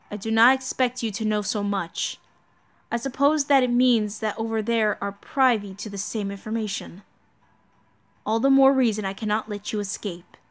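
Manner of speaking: low, grave knight, measured menace; stern knight Lancelot. Acted. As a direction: speak low as a cold, duty-bound knight. Voices Lancelot in the game.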